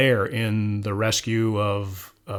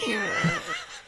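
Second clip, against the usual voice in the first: rising high giggle; 5 dB quieter than usual. Real, not acted. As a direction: break into a giggle. Cracking up after a deadpan joke.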